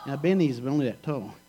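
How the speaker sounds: usual speaking voice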